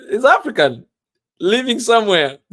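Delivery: loud, laughing words; real amusement. Real, not acted. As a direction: break into laughter as you say it. Cracking up while telling story.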